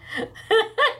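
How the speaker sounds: a burst of high laughter. Real, not acted. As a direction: laugh out loud at the memory. Laughs at her own story.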